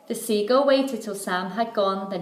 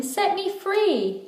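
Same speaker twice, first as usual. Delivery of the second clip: much higher, slow plea; begging; 11 semitones higher, slower than usual. A narrator's reading. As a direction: mermaid begging Sam Sly to let her go. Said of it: "And when she begged him".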